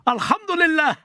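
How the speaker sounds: high-pitched, drawn-out call of praise; mock Arabic exclamation. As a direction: cry it out high, hammed-up Arabic. Put-on exclamations in Arabic, questioned.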